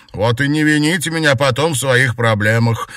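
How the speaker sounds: loud, high and indignant; huffy parting shot. A narrator's reading. Maid's last word to her mistress, indignant. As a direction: toss it out while storming off.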